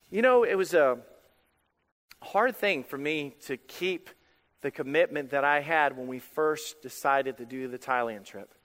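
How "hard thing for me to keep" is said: ordinary talk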